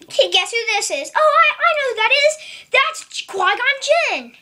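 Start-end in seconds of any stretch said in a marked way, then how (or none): 1.2-4.4 higher, louder, fast eager voice; eager guesser. Pretend. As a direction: blurt it out like a know-it-all toy. Voicing a toy guessing.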